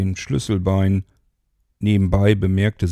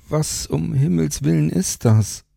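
put-on raised pitch, startled young woman; Mara's voice; 9 semitones higher than usual. A narrator's reading. Narrator voicing Mara surprised by the stranger, higher voice.

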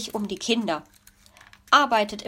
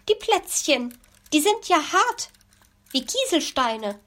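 a higher-pitched indignant complaint; higher whiny gripe; complaining; 7 semitones higher than usual. Acted. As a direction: grumble about the rock-hard cookies. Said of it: "beschwerte sich die Elster".

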